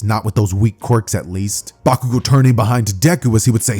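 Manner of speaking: ordinary delivery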